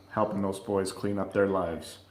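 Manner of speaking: ordinary talk, nothing marked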